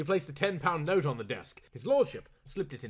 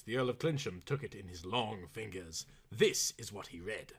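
ordinary delivery

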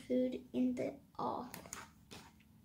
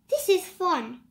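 loud, higher happy cry; cried out; 4 semitones higher, 8 dB louder than usual. A narrator's reading. Noah bouncing on his pogo stick, crying out happily. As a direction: shout it with glee. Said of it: "Noah cried".

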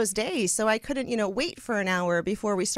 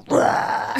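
rock growl; 6 dB louder than usual. Retold or imitated. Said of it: "and then go".

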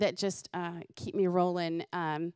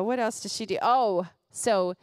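ordinary talk, nothing marked

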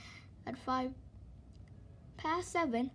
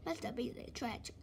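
ordinary delivery, nothing marked

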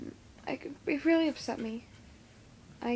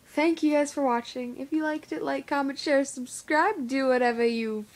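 upbeat outro; 2 semitones higher, 6 dB louder than usual. Acted. Video outro sign-off.

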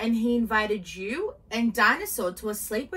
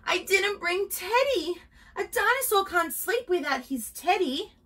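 high-pitched story-voice panic; distressed cry; 8 semitones higher than usual. A narrator's reading. Dinosaur realising Teddy is missing, high dismayed cry. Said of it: "gave a gasp and cried".

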